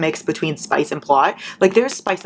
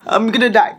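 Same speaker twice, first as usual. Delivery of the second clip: choking; 6 semitones higher, 4 dB louder than usual. Real, not acted. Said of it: "I almost died".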